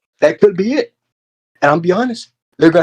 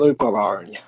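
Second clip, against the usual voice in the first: "Let me put my arm around you" softer and lower, creepy-friendly; soft menace; 6 semitones lower, 6 dB quieter, much faster than usual. Acted. Mock-friendly gesture to partner.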